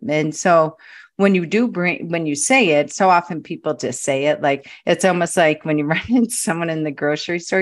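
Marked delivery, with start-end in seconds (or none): none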